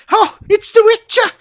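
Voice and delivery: Witcher ending in an A sound, high and whiny; imitating a teammate's accent, high and whiny; mock accent. Teasing a teammate by quoting him in his accent. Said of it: "[name] is in the background going".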